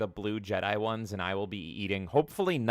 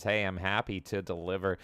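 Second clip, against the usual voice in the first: ordinary delivery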